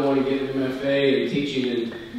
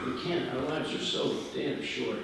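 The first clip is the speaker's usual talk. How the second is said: ordinary talk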